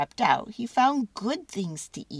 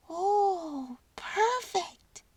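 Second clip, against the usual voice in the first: high, squeaky little mouse; a tiny delighted mouse; squeaky mouse delighted with his find; 11 semitones higher, much slower than usual. A narrator's reading. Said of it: "said Mortimer".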